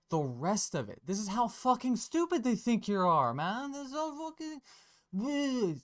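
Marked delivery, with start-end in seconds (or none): 1.1-3.7 much higher and louder, outraged; a fed-up rant at the people who think you're stupid; exasperated outburst. Real, not acted. Ranting at how stupid they think you are. 3.7-5.8 much higher, slow, dissolving into goo; a comic melt-down voice. Pretend. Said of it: "I melted in real time so that you can be given some kind of demonstration".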